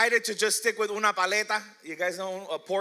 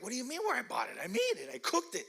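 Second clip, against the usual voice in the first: higher, mock-offended protest; indignant; 5 semitones higher, 5 dB quieter, faster than usual. Answering guests who think he bought it, in high mock indignation. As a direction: squeak up in disbelief.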